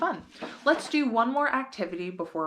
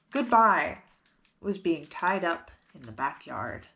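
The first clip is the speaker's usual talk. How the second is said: ordinary delivery, nothing marked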